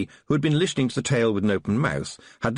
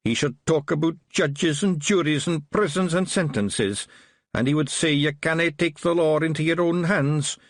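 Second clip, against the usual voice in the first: higher-pitched Scottish little fighting man; Wee Mad Arthur; 7 semitones higher than usual. A narrator's reading. Small Feagle policeman to Tiffany, higher Scottish brogue.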